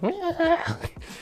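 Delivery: high-pitched mock wail; Carlton running away. Recapping Kev fleeing down the hallway like Carlton, high mock wail. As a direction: imitate a silly, high fleeing cry. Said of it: "running down the hallway like Carlton".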